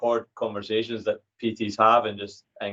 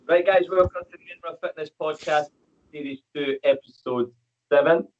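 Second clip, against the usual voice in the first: upbeat host intro, higher pitch; podcast intro; 5 semitones higher than usual. Acted. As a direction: open the show like a radio host.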